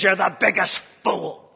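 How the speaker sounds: loud, mock-Irish heckling; imitated heckler's shout. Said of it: "shouted me down on every point".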